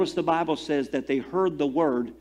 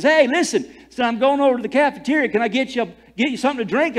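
bright, higher friendly coworker voice; coworker offer; 8 semitones higher, 5 dB louder, faster than usual. Retold or imitated. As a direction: voice the friendly guy calling out. Coworker voiced in retold story.

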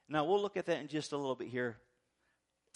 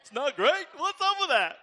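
mock wife complaint; 10 semitones higher, 9 dB louder than usual. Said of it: "I'm gonna use it the next time".

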